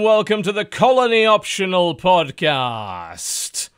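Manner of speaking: slow, high showman welcome; announcer voice. Acted. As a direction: draw it out like a ringmaster. Host opening the show.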